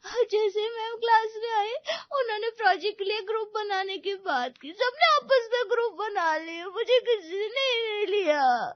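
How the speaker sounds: high, tearful wail of hurt; left-out child sobbing to mother. Acted. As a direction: cry harder, dragging out the last words. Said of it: "सिसकती हुई मीरा".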